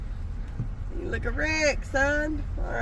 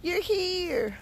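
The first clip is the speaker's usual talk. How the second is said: high, drawn-out pet voice; playful mock relief for an animal; voicing the animal; 4 semitones higher, slower than usual. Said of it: "He's like".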